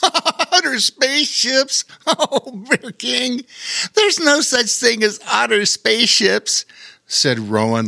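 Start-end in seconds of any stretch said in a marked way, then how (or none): 0.0-6.6 put-on high young voice through laughter; laughing boy. A narrator's reading. Rowan laughing at the bear's song, high giggly voice. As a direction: giggle at the silly idea. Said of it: "laughing now at the absurd idea".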